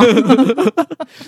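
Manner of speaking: bright burst of laughter. Real, not acted. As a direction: laugh at the memory. Laughing at her own story.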